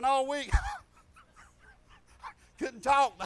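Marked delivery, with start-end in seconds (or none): 1.6-3.3 laughing fit. Retold or imitated. Teresa laughing all week.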